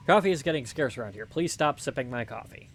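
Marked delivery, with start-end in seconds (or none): none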